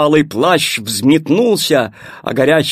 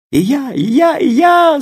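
rising louder, higher eager cries; excited shout; 11 semitones higher, 6 dB louder than usual. Acted. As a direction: pile on each time higher. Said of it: "закричали хлеб, вода, огонь". (возбуждённый, нарастающий крик)

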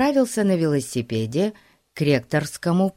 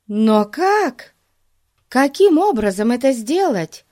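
put-on high, loud, questioning girl's voice; Daisy; 8 semitones higher, 5 dB louder than usual. A narrator's reading. Daisy asks how it could be done, puzzled high voice. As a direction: ask it high and insistent, puzzled.

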